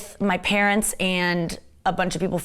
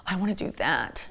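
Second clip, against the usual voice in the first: soft unvoiced whisper of longing; 6 dB quieter than usual. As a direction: breathe it out wistfully. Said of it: "I'm like".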